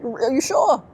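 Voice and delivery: high-pitched sceptical questioning; mock disbelief. Answering family who claim they always believed, mock disbelief. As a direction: squeak out doubt, playing at being unconvinced.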